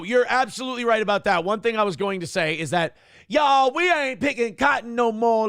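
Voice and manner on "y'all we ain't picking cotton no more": higher, louder mock sermon voice; hamming up a church crowd line. Mocking a politician's folksy church talk. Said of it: "like that fucking bouncing head".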